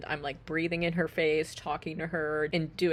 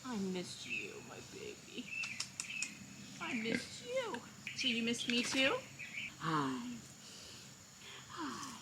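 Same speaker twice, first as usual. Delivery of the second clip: high, softer cooing; tender pig-mom cooing; 7 semitones higher, 7 dB quieter than usual. Real, not acted. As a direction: murmur sweet nothings to her. Baby talk to pig.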